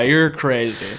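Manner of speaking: ordinary delivery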